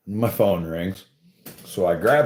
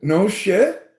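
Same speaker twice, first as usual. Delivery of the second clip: amazed disbelief; 10 semitones higher, 3 dB louder than usual. Retold or imitated. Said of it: "And I'm like".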